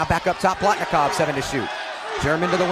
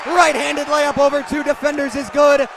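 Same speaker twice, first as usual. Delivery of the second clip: excited call; 6 semitones higher than usual. Real, not acted. Announcer calls the layup that extends the home run, excited. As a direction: lift the call with the surging run.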